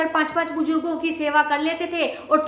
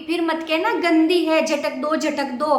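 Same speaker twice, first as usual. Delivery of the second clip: usual speaking voice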